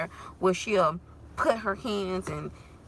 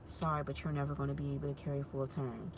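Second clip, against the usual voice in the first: doctor's bad news; 7 semitones lower, 11 dB quieter, faster than usual. Doctor giving bad news, retold.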